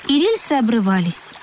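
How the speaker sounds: usual speaking voice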